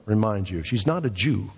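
ordinary talk, nothing marked

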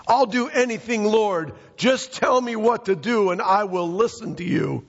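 high-pitched, eager obedience; pleading stranded climber. Acted. Jack begging the Lord for instructions, pleading. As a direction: promise anything in a high, urgent voice.

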